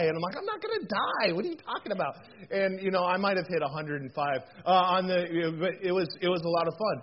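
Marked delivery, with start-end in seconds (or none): 0.4-2.1 mock dismissive retort. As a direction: protest in a squeaky-high pitch, then come down. Brushing off his worried kids.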